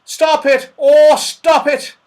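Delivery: high, loud plea; shouting Saucepan Man. A narrator's reading. Saucepan Man tossed about above, shouting in distress. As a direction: cry out for it to stop, high-voiced. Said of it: "shouted the saucepan man".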